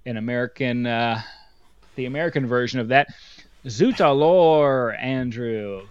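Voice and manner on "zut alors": hammed-up French exclamation; slow, louder mock French; French accent. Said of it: "is what I say".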